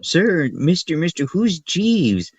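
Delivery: put-on high, pleading child's voice; mock kid asking; curious kid. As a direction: tug at the teacher like an eager kid. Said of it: "they looked at me".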